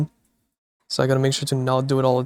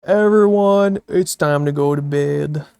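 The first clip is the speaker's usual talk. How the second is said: calling bedtime; 6 dB louder than usual. Acted. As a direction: sing out bedtime like a camp counselor. Announcing bedtime to chat.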